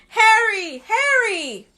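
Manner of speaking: high, loud calling out; crying out. Acted. Voices in Harry's head crying his name, high and loud. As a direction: call his name from far away. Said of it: "other voices crying".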